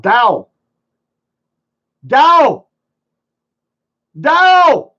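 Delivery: high, loud calls across the room; calling out for a friend on stream, real loud yells; screaming a name. Real, not acted. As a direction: yell his name to get him to answer. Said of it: "Sorry, I'm screaming".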